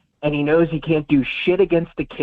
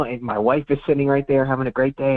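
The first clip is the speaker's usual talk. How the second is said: usual speaking voice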